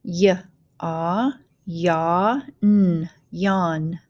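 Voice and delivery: "yawn" built up sound by sound; teacher blending "yawn" with gaps between sounds; phonics blending. Shown as a demonstration. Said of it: "go ahead and start blending".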